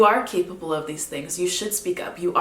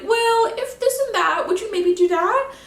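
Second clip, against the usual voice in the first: high, hesitant asking voice, pitched well up; tentative plea; 4 dB louder than usual. Retold or imitated. Songwriter acting a lyric's timid lover, high and hesitant. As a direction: voice a shy lover hedging a request.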